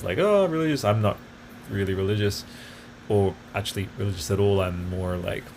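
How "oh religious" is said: mock pious gush. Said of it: "not in that term of".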